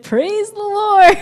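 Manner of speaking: high, loud, slow, bursting with laughter; laughing praise. Retold or imitated. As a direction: crack up as you cry it out. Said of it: "He starts laughing".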